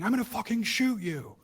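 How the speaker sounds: high, loud mock yell of an angry cop; mimicked screaming cop. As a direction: bark it like a raging cop. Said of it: "And they're screaming".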